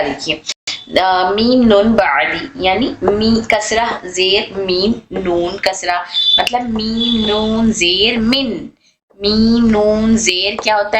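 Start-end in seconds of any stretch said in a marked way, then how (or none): 1.0-6.0 मीम नून बादी spelled out very slowly; slow recitation. Shown as a demonstration. Teacher slowly spells the example for students. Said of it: "जैसे यहाँ पर आपको दिखा रहा".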